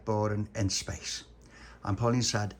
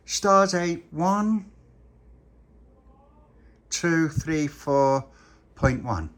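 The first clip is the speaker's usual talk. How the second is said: loud, higher, very slow count; captain's log announcement; 4 semitones higher, 6 dB louder, much slower than usual. Acted. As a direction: spell out the date grandly and slowly.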